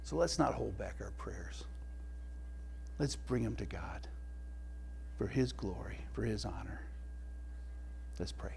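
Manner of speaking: soft, whispered call to prayer; hushed prayer invitation. Real, not acted. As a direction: draw the congregation quietly into prayer.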